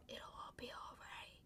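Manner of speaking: eerie, very quiet whisper; creepy little voice. Retold or imitated. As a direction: whisper it like a creepy alter ego. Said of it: "in her, like, creepy little voice".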